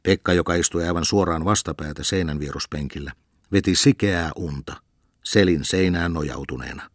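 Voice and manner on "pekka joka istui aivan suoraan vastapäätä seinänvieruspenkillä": ordinary delivery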